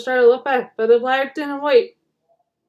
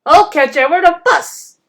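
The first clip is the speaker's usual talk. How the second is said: grim; 5 semitones higher, 7 dB louder than usual. A narrator's reading. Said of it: "said Bertie grimly".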